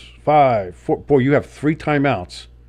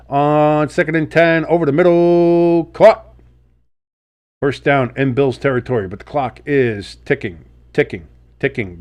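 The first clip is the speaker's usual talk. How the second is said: louder, higher, building up to caught; play-by-play call; 3 semitones higher, 4 dB louder than usual. Acted. Streamer calling the Lions first down, excited play-by-play. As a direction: call the catch over the middle live.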